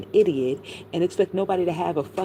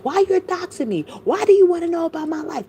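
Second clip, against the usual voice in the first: high-pitched, loud mocking impersonation; mock indignant quote; 11 semitones higher, 6 dB louder than usual. Vlogger retells Allie objecting to being doxed, mock indignant. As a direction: imitate her complaint, much higher and louder. Acts out Allie confronting someone.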